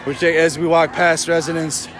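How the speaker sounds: ordinary talk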